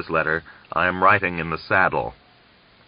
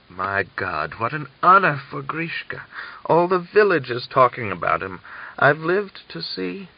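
lightly voiced moved old man; a hint of a tearful old man muttering; old man mumbling; 8 semitones higher than usual. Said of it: "the old man muttered".

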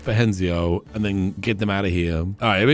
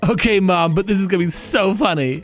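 higher-pitched, keen joke telling; eager comedian daughter; 9 semitones higher than usual. Pretend. Comedian insisting to Mom the joke is funny, eager delivery. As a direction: push on eagerly with the bit. Plays Miranda persisting with joke.

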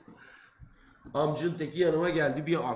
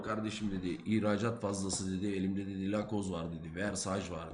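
hushed seller pitch; 9 semitones lower, 6 dB quieter than usual. Retold or imitated. Street seller to students at the airport, hushed and sly. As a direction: lower and softer, a sly salesman murmuring.

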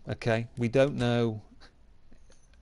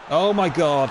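shocked exclamation; 6 semitones higher, 8 dB louder than usual. Real, not acted. Reacting live to a match moment.

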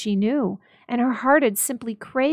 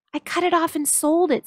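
put-on higher, earnest young wife; Della pleading; 8 semitones higher, 3 dB louder than usual. A narrator's reading. Della telling Jim she sold her hair. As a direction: insist gently on what she did.

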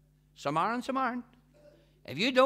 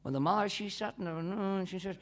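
ordinary delivery

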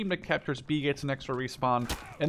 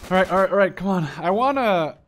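hyped up; 4 semitones higher, 9 dB louder than usual. Real, not acted. Urging himself on mid-fight.

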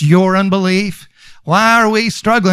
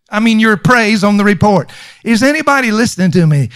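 normal talking voice

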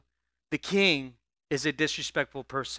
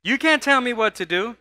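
loud, higher, sassy defiance; with an attitude; 7 semitones higher, 8 dB louder than usual. Shown as a demonstration. Congregation led to say it defiantly, loud and sassy. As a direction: throw the line back in full defiance. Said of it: "Let's all say that with an attitude".

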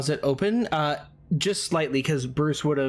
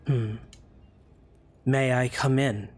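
sultry voice; 2 semitones lower than usual. Acted. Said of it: "And the sultry voice responds".

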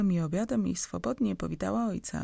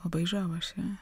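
ordinary delivery, nothing marked

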